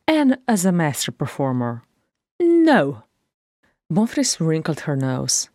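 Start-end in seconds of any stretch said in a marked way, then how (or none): 2.4-3.0 a high, louder, proud no; the singer refuses her sister, dignified and firm; dignified refusal. A narrator's reading. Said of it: "drawing upon every ounce of dignity".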